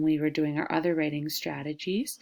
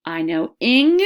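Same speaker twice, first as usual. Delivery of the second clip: excited; 8 dB louder than usual. Shown as a demonstration.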